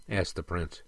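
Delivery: ordinary delivery, nothing marked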